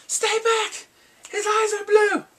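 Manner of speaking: high, loud alarmed scream; shouted warning. Acted. Night's Watch man warning the others, high shouted alarm. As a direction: yell it as a frantic warning. Acts out panicked warning from the show.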